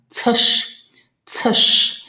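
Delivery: "tsh" as ts sliding into a long sh; isolated tsh sound. Shown as a demonstration. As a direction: hiss out "tsh" on its own. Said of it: "we have the tsh sound".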